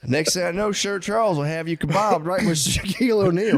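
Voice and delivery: high, worried southern dad; hammed-up dread with a laugh; fretting father. Father warns daughter about Shaq, fretting. Co-host joins as upset father.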